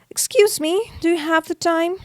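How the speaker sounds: high, polite little mouse voice; polite high ask. Acted. Mouse asking a rodent in line, politely and high.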